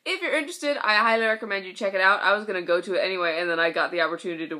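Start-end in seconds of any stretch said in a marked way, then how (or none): none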